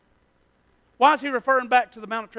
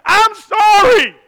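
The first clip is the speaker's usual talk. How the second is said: mock apology; 13 semitones higher, 16 dB louder, much slower than usual. Acted apology in sermon.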